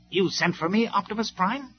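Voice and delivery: high mock-innocent question; sneering. A narrator's reading. Starscream arriving confidently before Optimus, sneering.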